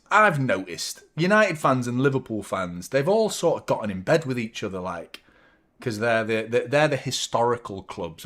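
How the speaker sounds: normal talking voice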